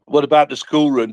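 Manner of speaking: usual speaking voice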